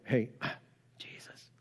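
hushed whisper with a sigh; mother's sighing whisper; whispered plea. Retold or imitated. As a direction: lean in and whisper to your son. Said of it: "You know, they go".

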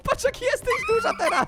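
high squeal through laughter; laughing hard. Real, not acted. Player shows friend how big she is, high laughing squeal. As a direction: squeak it out while cracking up.

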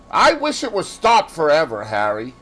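high, loud, bitter outburst, dropping on the name. A narrator's reading. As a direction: answer with rising bitterness. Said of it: "he answered bitterly".

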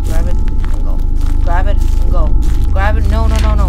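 urgent. Real, not acted. Urging himself under pressure in game.

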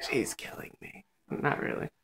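whispered aside; hushed breathy aside; whispering. Real, not acted. Aside whispered about sister.

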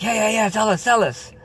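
imitating eager children; eager kids. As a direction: beg him to tell, all excited. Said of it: "And we're like".

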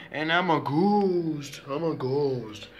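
long, drawn-out slow ghost wail of words; pretend ghost. Child announcing a ghost costume change, spooky drawn-out ghost voice. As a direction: stretch it out like a play ghost.

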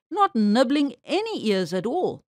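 plain reading voice

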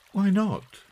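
high, surprised query; puzzled mother questioning him; mother's question. A narrator's reading. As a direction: pitch it high, taken aback. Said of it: "asked Mother".

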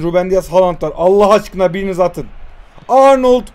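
normal talking voice

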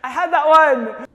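loud exclamation. Real, not acted. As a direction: blurt it out in frustration. Frustrated outburst after flub.

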